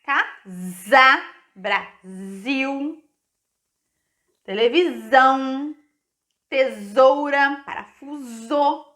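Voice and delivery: word by word, voiced Z in "casa, Brasil, televisão, tesoura, parafuso"; S sounded as Z. Shown as a demonstration. Teacher showing pupils the borrowed sound, buzzed Z for the S in "casa, Brasil, televisão, tesoura, parafuso". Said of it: "ele pega o som do Z emprestado".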